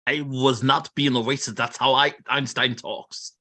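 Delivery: mock German accent, slowing at the end; Einstein impression. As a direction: answer in a put-on German professor accent. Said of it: "that's just a German accent".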